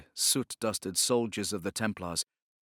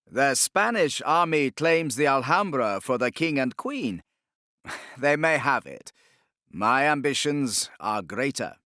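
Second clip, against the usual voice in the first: higher, louder grand declaration; lofty Inquisitor; 5 semitones higher, 7 dB louder than usual. Acted. Torquemada telling the Sultan his aims, lofty. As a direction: proclaim with disdainful ambition.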